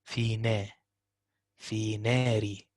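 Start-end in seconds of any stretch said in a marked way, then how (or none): none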